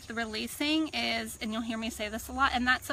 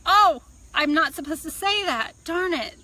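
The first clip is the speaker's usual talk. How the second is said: higher, louder exasperated outburst; mock self-scolding; 6 semitones higher, 8 dB louder than usual. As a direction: scold yourself in playful frustration. Acting out self-scolding after apologizing.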